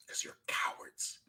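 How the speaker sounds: hushed, breathy whispered accusation; a cutting whisper to his detractors. Real, not acted. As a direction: lean in and whisper the insult.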